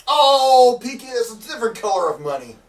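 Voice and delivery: loud, high protest, easing off; exasperated outburst. Acted. Exasperated with co-host's needling.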